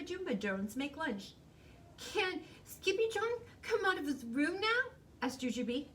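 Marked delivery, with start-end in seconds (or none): 2.0-4.9 put-on high-pitched child's question; high, pleading kitten voice; little sister kitten. A narrator's reading. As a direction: ask mama in a small child's high voice. Little sister cat asks mother.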